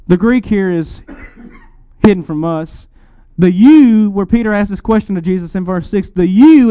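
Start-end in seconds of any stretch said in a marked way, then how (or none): 3.5-4.1 YOU hit hard and much louder; "you" stressed, sharply louder; emphatic you. Shown as a demonstration. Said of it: "is intended to be emphatic". 6.3-6.7 YOU hit hard and much louder; "you" stressed, sharply louder; emphatic you. Shown as a demonstration. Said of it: "is intended to be emphatic".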